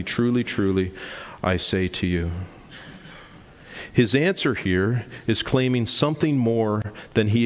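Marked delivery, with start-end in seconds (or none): none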